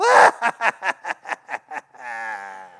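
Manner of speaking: loud cackling witch laugh; evil witch cackle. Retold or imitated. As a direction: cackle like the witch over her spell. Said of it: "She's doing a spell".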